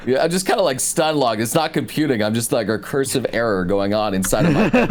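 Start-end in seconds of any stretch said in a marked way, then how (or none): none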